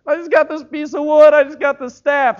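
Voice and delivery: high, loud, protesting Moses; reluctant Moses. Acted. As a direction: plead your way out of the call. Moses voiced.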